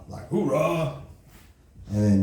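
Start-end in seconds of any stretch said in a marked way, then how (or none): 0.3-1.0 "Hoorah" barked in a tighter voice, falling; marine hoorah. Shown as a demonstration. Comic demo of the Marine cry, firm "Hoorah". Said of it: "the Marines, they say".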